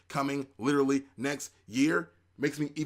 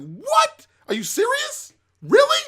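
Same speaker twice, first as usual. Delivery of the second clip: shrieking high, loud shocked disbelief; 11 dB louder, slower than usual. Retold or imitated. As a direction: squeal it like you can't believe she died. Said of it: "I was like".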